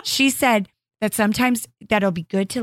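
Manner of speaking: usual speaking voice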